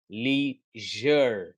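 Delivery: लीजर very slowly with "zh", "lee-zhur"; correct ZH sound. Shown as a demonstration. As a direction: stretch लीजर with "zh" in the middle. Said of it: "उसका sound होगा ज". (लीजर को खींचकर "लीझ़र" बोलें)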